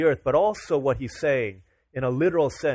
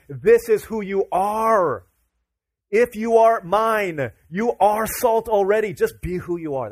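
slow, loud, higher proclamation; very slow, louder voice of Jesus; Jesus proclaiming; 7 semitones higher, 8 dB louder, slower than usual. Acted. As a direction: declare it as a divine promise. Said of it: "He's saying".

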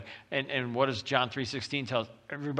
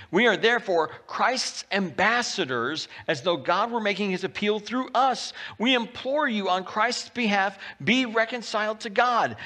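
higher, louder preaching voice; fervent preaching; 6 semitones higher, 4 dB louder than usual. Acted. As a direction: preach it out with fervor. Reads scripture and preaches.

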